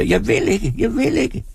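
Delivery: high desperate scream; screaming woman. A narrator's reading. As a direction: fight them off screaming. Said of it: "slår atter fra sig og skriger". (kvinde der skriger)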